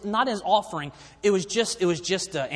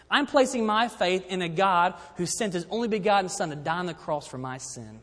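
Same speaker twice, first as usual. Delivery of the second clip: usual speaking voice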